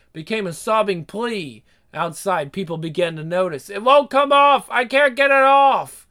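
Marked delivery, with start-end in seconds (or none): 3.7-6.1 high, loud whining scream. Acted. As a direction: shriek it in desperation. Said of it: "His voice rose to a whining scream".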